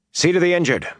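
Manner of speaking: high-pitched battlefield order; commanding. A narrator's reading. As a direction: call out an order to your men. Hero commanding soldiers after fight.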